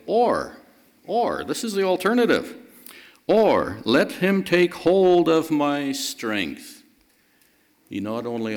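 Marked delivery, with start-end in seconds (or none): 0.0-0.6 or said higher and louder; stress on or. Shown as a demonstration. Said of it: "a very important word". 3.3-6.8 or stressed, slow, louder and higher; slow emphatic reading. Shown as a demonstration. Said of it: "a very important word at the beginning".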